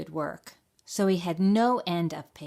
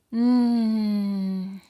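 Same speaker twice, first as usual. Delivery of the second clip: long, louder sleepy hum; dreamy mumbled hum; 4 semitones higher, 6 dB louder than usual. A narrator's reading. Dozing fox dreaming of a meal, mumbling. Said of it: "he mumbled".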